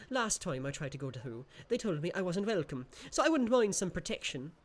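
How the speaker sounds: a tiny imp asking for protection; small creature. Acted. Voices the creature.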